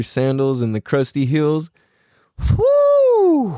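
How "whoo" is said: excited whoop. Real, not acted. Hyping grill uncle.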